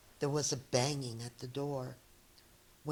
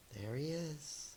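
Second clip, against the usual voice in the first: hushed hope; 3 semitones lower, 16 dB quieter than usual. Acted. Onlookers murmuring hopefully.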